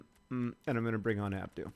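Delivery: ordinary talk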